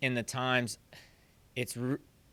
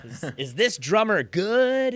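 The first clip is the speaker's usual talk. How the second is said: slow, higher, louder hype voice; mock video-title question; 5 semitones higher, 5 dB louder than usual. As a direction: ham it up like a clickbait teaser. Mock thumbnail title, hammed up.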